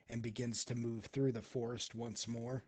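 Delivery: ordinary talk, nothing marked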